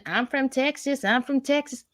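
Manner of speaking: high mocking imitation of Crockett; sing-song mock of a proud Texan; mocking Crockett's Texas brag. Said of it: "the shoulder bouncing".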